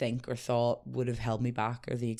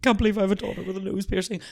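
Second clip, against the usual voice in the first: mock-upset mum, quick and higher; retold distraught mum; 7 semitones higher, 4 dB louder, faster than usual. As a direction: imitate an upset mother in a higher, hurried wail. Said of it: "my mum cried, like, sobbed".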